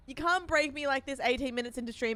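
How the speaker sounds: ordinary talk, nothing marked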